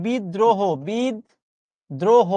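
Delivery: "বিদ্রোহ" as bid... droho, slowly; syllable-split pronunciation. Shown as a demonstration. Teacher drilling an exam word, "বিদ্রোহ" as bid-droho. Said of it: "পরীক্ষায় প্রশ্ন আসতে পারে".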